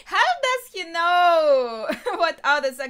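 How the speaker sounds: loud laughing disbelief, voice leaping up; amazed laughing. Real, not acted. Viewer amazed he knows what others will say, laughing. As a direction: burst out through real laughter. Amused reaction to predicted lines.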